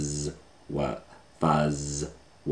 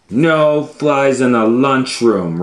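loud, higher, bossy no-flies rule; stern lunch lady shout; 7 semitones higher, 10 dB louder than usual. Acted. Lunch lady shouting at the fly, loud and stern. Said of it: "Roz said".

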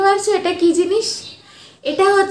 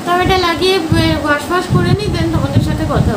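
stiff barely moving lips; restrained careful speech; through a face mask; 2 semitones higher than usual. Real, not acted. Seen 1: Sheet mask covers face while talking; removed by 8.7.

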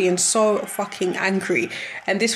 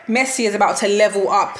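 ordinary delivery

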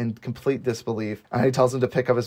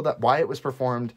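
usual speaking voice

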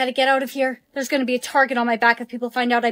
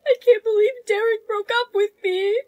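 crying; 7 semitones higher than usual. Acted. Heartbroken after breakup.

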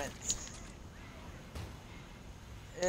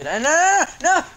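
high, loud rising panic; frantic no from tense waiting; panicked no; 14 semitones higher, 12 dB louder than usual. Real, not acted. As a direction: plead as doom approaches. Fearing being infected.

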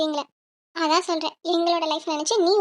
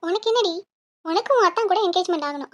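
normal talking voice

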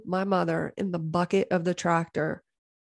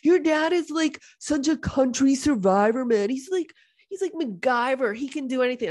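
higher, gushing impression of Facebook friends; mock gushing friends; 6 semitones higher than usual. Facebook friends gushing over her dad, in a higher mock voice. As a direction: gush about how capable he is. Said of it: "everybody's like".

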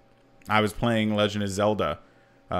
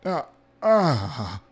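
dazed grunts; 5 semitones higher than usual. Retold or imitated. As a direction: grunt like you can't form words. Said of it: "the sound I made".

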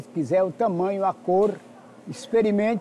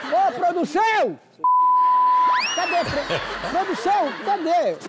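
chamando socorro; 9 semitones higher than usual. Real, not acted. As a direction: shout for help, alarmed. Chama a equipe aflito. (pedindo socorro à produção)

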